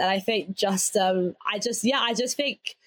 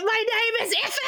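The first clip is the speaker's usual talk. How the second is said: high laughing shout, half joking; 12 semitones higher, faster than usual. Retold or imitated. Retelling grabbing the boy, she laughs out a high shout. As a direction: yell it with a laugh as the kid grabbing a bully. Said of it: "I was like".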